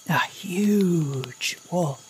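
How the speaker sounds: a slow, breath-only hush of awe; awed whisper. Acted. Father telling of the huge wolf, slow awed whisper. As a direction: let the size of it steal your breath.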